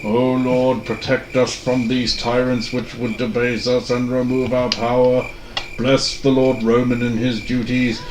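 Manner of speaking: slow, hushed solemn prayer. Acted. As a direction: pray quietly and solemnly. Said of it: "We're praying now".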